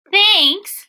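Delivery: put-on high, bright thank-you of a little girl. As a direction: voice Lola, high and glad. Lola thanks Gilbert.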